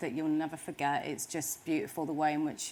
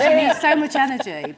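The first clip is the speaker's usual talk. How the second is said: laughing; 8 semitones higher, 13 dB louder than usual. Real, not acted. Laughing about dancing.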